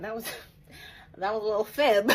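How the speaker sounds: loud, high laughing confession; laughing at her fib. Real, not acted. Confessing the fake email threat, laughing loudly. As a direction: cackle at her own cheek.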